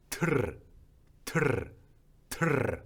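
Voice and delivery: 트르 with a short, strong trilled r; 트르 said with a quick forceful rolled r. Shown as a demonstration. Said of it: "짧고 강하게 혀를 떨어줍니다".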